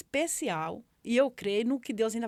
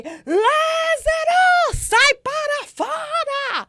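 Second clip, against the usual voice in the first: high, loud, long-held cry; triumphant shout; 12 dB louder, much slower than usual. Retold or imitated. Mother shouting to her son coming out of the hospital, full-voiced shout. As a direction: call Lazarus out of the tomb at full voice. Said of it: "eu gritei".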